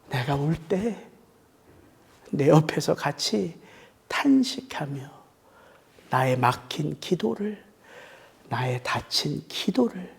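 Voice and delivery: softly assures weeping believers of the Spirit's help; tender hush. Real, not acted. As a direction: comfort someone who is crying. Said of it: "tender soft close on the Spirit".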